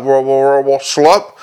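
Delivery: loose, garbled syllables; drugged babbling. A narrator's reading. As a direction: lose the words into slurred gibberish. Drugged constable losing his words.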